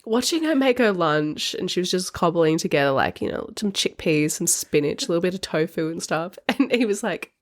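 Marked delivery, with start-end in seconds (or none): none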